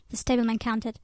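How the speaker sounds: ordinary delivery, nothing marked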